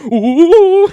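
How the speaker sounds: high, loud drawn-out ooh; nervous freak-out whoop. Retold or imitated. Kid freaks out when someone gets too close, high whoop. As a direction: imitate a kid's panicked hoot. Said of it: "he'd freak out 'cause he was so nervous".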